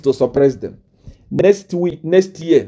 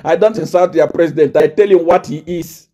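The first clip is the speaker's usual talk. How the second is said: ordinary talk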